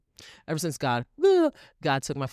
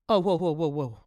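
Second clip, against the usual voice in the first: raised, louder whoas of fake shock; mock surprise; 3 semitones higher, 4 dB louder than usual. Vlogger mocking a fake friend's unmasking, mock surprise. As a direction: play-act being startled by someone.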